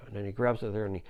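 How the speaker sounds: ordinary delivery, nothing marked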